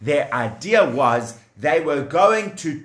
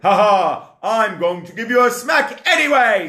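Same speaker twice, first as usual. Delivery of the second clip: gloating bully; 5 semitones higher, 4 dB louder than usual. Acted. Taskmaster gloating.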